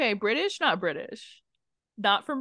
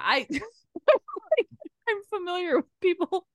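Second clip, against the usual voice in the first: ordinary talk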